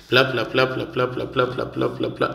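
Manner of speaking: muffled wah wah talk, like an adult in a cartoon. Shown as a demonstration. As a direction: babble the sentence as wah wah. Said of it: "long run of blah blah". (كلام مكتوم زي واه واه)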